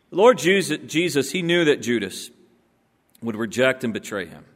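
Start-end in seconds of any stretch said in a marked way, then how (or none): none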